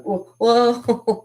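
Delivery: high, loud retold shock; mock alarmed whoa. Reacting to a magic trick in a story, mock alarm. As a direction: act out recoiling at a frightening trick. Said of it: "but then I saw that".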